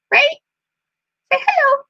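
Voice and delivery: very high sing-song coaxing to the bird; high pet-talk coaxing. Real, not acted. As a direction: coax the parrot in a squeaky voice.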